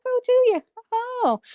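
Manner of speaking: high mock surprise; surprised. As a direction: squeal a teasing "oh, do you". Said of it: "I was like".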